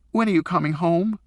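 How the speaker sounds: put-on a kid close to tears begging; tearful boy. A narrator's reading. Son crying on the phone, begging his father to come home. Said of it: "Eric started to cry".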